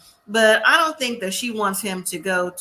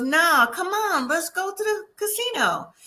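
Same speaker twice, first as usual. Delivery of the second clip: coaxing; 7 semitones higher than usual. Retold or imitated. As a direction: lightly act out a boyfriend's wheedling. Retold boyfriend pressing her to go.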